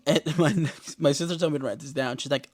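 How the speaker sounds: usual speaking voice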